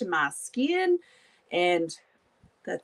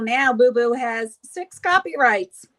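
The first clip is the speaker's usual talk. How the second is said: ordinary delivery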